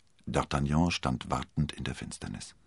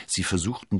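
usual speaking voice

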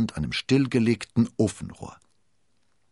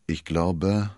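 usual speaking voice

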